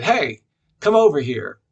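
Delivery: loud call across the restaurant. Retold or imitated. Older woman calling him over, loud call.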